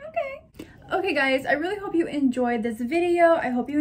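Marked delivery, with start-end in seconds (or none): 0.1-0.7 squeaky cry. Real, not acted. Squeaky cry after story.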